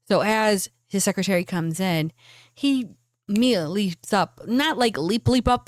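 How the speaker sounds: ordinary delivery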